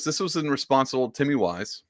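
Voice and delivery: normal talking voice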